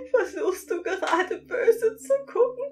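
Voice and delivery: high, laughing sprite voice; teasing laugh. A narrator's reading. Sprite teasing the narrator's glare, laughing high. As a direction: tease through giggles. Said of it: "Es lacht unaufhaltsam weiter".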